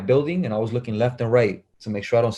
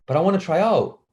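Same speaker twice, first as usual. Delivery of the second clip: higher-pitched protest; imitated pleading kid; 6 semitones higher than usual. Kid asking coach for a tryout, high-pitched plea. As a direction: plead a little, voice lifted high.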